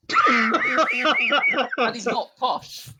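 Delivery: very slow, high, loud West Country Bristolian voice; hammed-up Bristolian accent. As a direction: drag it out in a broad Bristolian accent. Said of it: "mock Bristolian accent".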